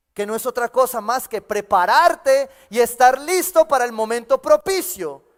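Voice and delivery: rising, loud preacher's build to a climax; preaching at full force. Acted. Pastor preaching hard to the church, loud and rising. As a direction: drive the point home to the congregation.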